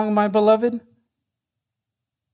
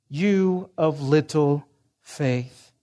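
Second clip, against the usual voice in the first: slow solemn quote; 5 semitones lower, 3 dB quieter, much slower than usual. Acted. Quoting the Lord's indictment.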